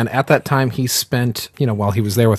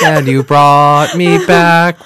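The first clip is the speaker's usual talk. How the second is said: slow, higher, louder dinosaur lament; mock dinosaur song; 7 semitones higher, 6 dB louder, slower than usual. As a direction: drag it out like a silly show tune. Continues dinosaur lyric.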